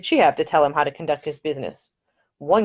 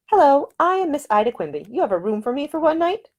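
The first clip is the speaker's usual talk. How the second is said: put-on a higher, eager young lady explaining her plans; a proud heroine making her case, raised in pitch; Ida's voice; 10 semitones higher than usual. Ida tells Nick why she is going to India, in a higher, eager voice.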